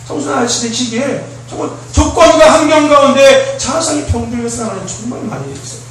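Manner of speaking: usual speaking voice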